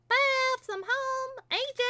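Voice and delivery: high, loud call into the house. Acted. Apple Bloom calling for her family as she gets home. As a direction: shout it through the house, high.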